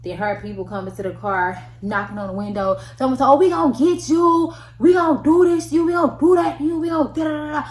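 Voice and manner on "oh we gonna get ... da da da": higher, loud mock shouting of threats; imitated yelling. People outside the police car, shouting threats loudly. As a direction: holler it through the car window. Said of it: "they just yelling and screaming and hollering".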